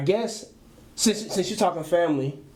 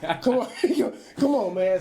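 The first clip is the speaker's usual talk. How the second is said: mock pleading; 4 semitones higher than usual. Begging brother not to tell.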